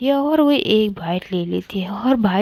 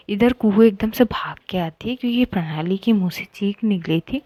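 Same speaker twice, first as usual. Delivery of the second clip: usual speaking voice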